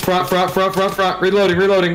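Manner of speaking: sharp, high combat callouts; urgent shout. Real, not acted. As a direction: yell it out mid-firefight. Firefight, calling out enemy.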